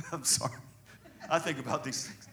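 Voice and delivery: soft, low chuckled aside; amused, hushed confession; laughing apology. Real, not acted. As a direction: say it through quiet laughter. Laughs at his own aside.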